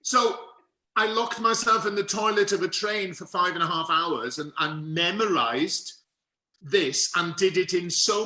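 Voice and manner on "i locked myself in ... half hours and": ordinary delivery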